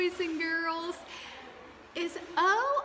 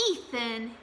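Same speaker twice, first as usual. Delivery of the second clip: ordinary talk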